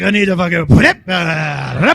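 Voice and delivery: loud, half-laughing retold strain; mock straining yell. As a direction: belt it like he's straining on stage. Retelling him straining, loud.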